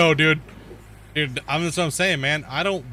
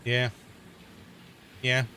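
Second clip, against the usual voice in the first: usual speaking voice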